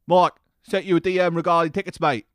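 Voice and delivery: mocking London-accent impression. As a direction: put on a cheeky Londoner's accent, loudly. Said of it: "it fits into the London accent too perfectly".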